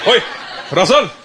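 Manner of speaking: put-on accented father, ominous and low; dad's threat. Dad threatens Russell before a beating, menacingly low. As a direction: call the boy, then drop low for the warning. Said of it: "That was my dad's threat".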